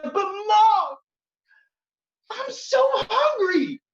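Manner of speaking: put-on high, slow whining child begging mom; whiny hungry child. Poet imitating their younger self begging mother for dinner, high whine. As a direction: whine it like a starving kid pleading. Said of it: "even when I was like".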